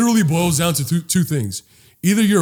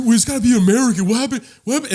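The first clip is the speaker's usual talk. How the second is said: mocking whiny complainer; 6 semitones higher, much faster than usual. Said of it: "everyone's like".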